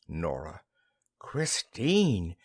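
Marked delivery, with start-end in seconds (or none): none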